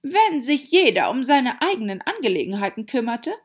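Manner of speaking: laute, hohe, knurrige Herzogin; heiseres Knurren. A narrator's reading. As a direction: gereizt und laut losknurren. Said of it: "knurrte die Herzogin heiser". (heiser geknurrt, laut und hoch)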